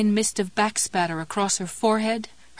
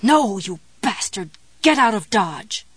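louder furious snarl; gritted-teeth fury; 4 dB louder than usual. Acted. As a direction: fight the pain with rage. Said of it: "she muttered through her clenched teeth".

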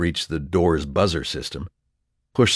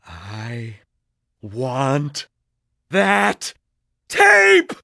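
erupting volcano scream; 7 semitones higher, 3 dB louder, much slower than usual. Acted. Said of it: "voice like an erupting volcano".

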